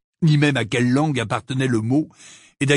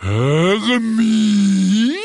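loud, drawn-out growling call, rising; rumbling giant; 5 semitones higher, 5 dB louder than usual. Acted. Giant recognising Hermione, calling with a rumbling roar. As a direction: growl the name out long like a giant. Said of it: "dit d'une voix profonde".

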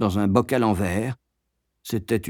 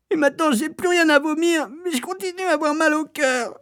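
very high, plaintive whine; snivelling. A narrator's reading. Boy feigning sickness to the matron, snivelling. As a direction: keep whimpering, laying on the misery. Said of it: "pleurnichai-je".

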